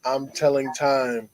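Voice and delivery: slow, low, matter-of-fact reply; deadpan. Acted. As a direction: answer unbothered, low and unhurried. Indian's deadpan answer in joke.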